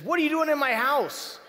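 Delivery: high, loud demand from the homeowner; retold homeowner, loud and alarmed; startled homeowner demanding. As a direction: call out the stranger in your house. Homeowner confronts intruding missionary.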